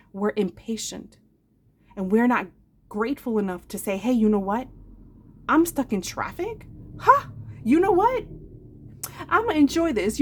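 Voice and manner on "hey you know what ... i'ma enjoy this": bright, rising, cheerful self-talk; upbeat mock cheer. To herself, stuck in traffic, cheerfully upbeat. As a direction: turn the traffic jam into a treat.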